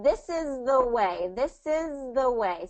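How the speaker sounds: slow, with the words linked; slow model phrase. Shown as a demonstration. Tutor modelling the phrase slowly for the learner, linked. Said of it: "break it down".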